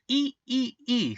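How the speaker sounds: very high tiny squeaks; mouse squeak. A narrator's reading. Field mouse answers the cat, squeaking high. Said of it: "squeaked the little field mouse".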